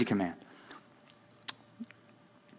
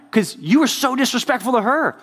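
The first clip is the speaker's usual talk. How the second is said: high-pitched, indignant reproach; mad about it; 10 semitones higher than usual. Real, not acted. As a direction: scold him, genuinely worked up. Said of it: "I'm mad about it now".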